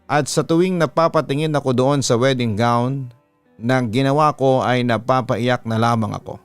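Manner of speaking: usual speaking voice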